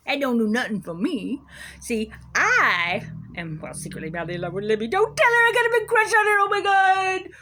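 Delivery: lightly voiced smug judge, confiding mock secrets; a hint of a giddy judge with a crush; parody judge. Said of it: "this is parody".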